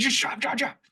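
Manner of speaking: high, tight urging; urgent pleading. Retold or imitated. Urging friend at the wheel, high and urgent. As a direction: beg him to drop it, fast. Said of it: "So I was like".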